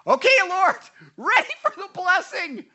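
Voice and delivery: loud, high-pitched eager call; impatient mock prayer. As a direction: call up to God, hammed-up and eager. Acts out his own impatient prayer.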